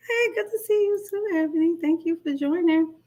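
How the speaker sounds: lifted high, welcoming greeting. Real, not acted. Greeting Ebony in the chat, high and welcoming. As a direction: pitch up to greet her.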